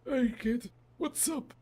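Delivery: grandpa voice. Shown as a demonstration. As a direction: redo the greeting as the cartoonish grandpa. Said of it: "wait, Grandpa".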